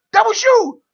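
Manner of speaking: high, loud scolding yell; retold scream. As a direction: yell the blame like a sore villain. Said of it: "screams at him".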